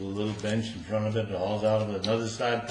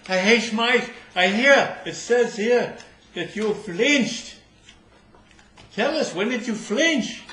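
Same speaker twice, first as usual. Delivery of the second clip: loud, high officious questioning; pompous judge; 10 semitones higher, 7 dB louder, slower than usual. Acted. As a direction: bark it like a stern court officer. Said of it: "The head of the court-martial says".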